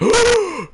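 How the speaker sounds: high, loud theatrical gasp; mock gasp. Courtroom crowd reacting to the twist, a hammed-up gasp. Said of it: "And everybody's like".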